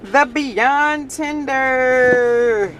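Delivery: very slow, stretched-out title; mock-grand unveiling; grand announcement. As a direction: announce it like a game-show prize.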